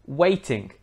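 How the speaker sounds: waiting with a clear T; T sounded. Shown as a demonstration. Teacher introducing the word waiting, clear T. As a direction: pronounce the T fully in waiting. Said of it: "With the glottal stop, it would sound more like".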